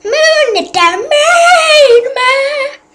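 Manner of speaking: excited shout. Real, not acted. Sudden drawn-out exclamation.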